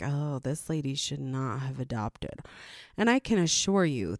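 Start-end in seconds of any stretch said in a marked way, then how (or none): 0.0-2.4 judging onlookers. Retold or imitated. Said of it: "people were like".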